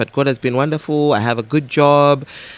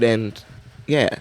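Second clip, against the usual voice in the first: ordinary talk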